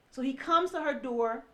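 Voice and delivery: ordinary delivery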